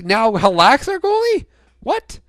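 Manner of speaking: high, sharp disbelieving question; sudden spike of surprise; baffled yelp; shocked. Real, not acted. Surprised at a goalie change.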